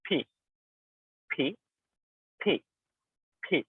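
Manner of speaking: ফি with a sudden burst from closed lips; ফি repeated with the air popped out; ফি with a crisp aspirated pop. Shown as a demonstration. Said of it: "হঠাৎ করে উচ্চারণটা হবে ফি".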